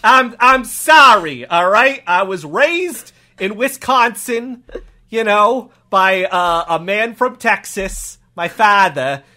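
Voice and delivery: put-on Boston-accented cop telling his sad backstory; comic cop, aggrieved and emphatic; wounded cop. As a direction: defend your accent like a hurt local cop.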